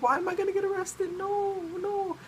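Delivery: very high, squeaky panicked wail; mock freak-out. Innocent classmate told of her arrest, high mock freak-out. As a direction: imitate a little girl wailing in panic. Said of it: "she got started to freak out".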